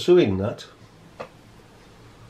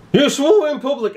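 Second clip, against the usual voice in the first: mimicking the officer; 9 semitones higher than usual. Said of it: "and he kept on".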